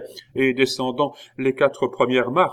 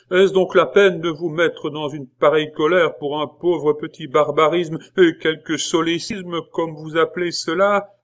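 pleading schoolboy; 7 semitones higher, 5 dB louder than usual. A narrator's reading. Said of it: "une autre voix suppliante".

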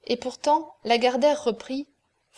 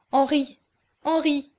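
higher, louder cry of his name; appel angoissé; 4 semitones higher, 4 dB louder than usual. A narrator's reading. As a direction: call out to him in distress. Aurore kneels, pleading with distressed Henri. (appel angoissé, plus fort)

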